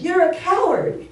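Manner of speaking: high, loud mimicked echo; acted voice shouting back. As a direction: throw the taunt back like an echo. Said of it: "And the voice says".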